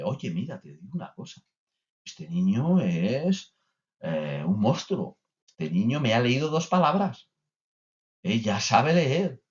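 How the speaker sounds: lightly voiced grandmother, excited and bragging; boasting grandma. Grandmother telling everyone the child can already read. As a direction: brag like a proud granny. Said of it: "va la abuela para casa y dice".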